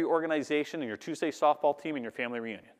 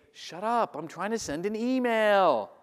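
high, drawn-out irritated whine; slow, exaggerated high-pitched complaint; mock annoyed outburst; 7 semitones higher, 3 dB louder, much slower than usual. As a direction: act out a peeved audience member. Annoyed audience member snaps, acted.

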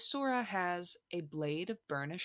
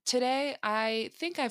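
excited announcement; 7 semitones higher, 6 dB louder than usual. Real, not acted. Excited topic intro.

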